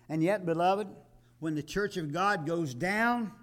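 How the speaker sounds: high, loud impassioned preaching, slowing at first; climactic preaching. Acted. Preacher pleads with beloved congregation, soaring impassioned preaching. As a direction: build to a ringing pulpit climax. Sermon climax over music.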